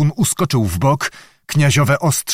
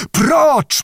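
even higher, more forceful command; thundering shout; 14 semitones higher, 3 dB louder than usual. A narrator's reading. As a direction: thunder the order at the Cossacks. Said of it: "powtórzył grzmiącym głosem". (grzmiący, wysoki okrzyk rozkazu)

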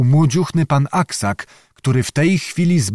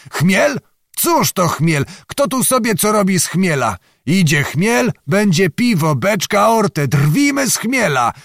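higher, swaggering rattle of scorn; blustering proudly; 7 semitones higher than usual. A narrator's reading. Zagłoba boasting to the knights about Chmiel, swaggering. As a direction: brush off the threat with bravado. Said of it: "trzepał pan Zagłoba, tocząc ... groźnie i dumnie".

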